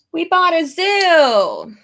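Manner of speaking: high, loud, stretched-out mock announcement; playful shouted exclamation. Streamer joking to viewers about buying a zoo, playful drawn-out shout. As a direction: holler it slowly, dragging out "zoo".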